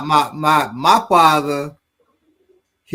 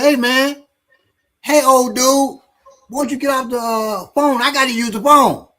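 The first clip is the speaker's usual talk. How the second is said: put-on higher, pushy young dude calling an old man off the phone; higher, impatient street voice; 7 semitones higher than usual. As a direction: rude stranger hustling for the phone. Rude young man at payphone.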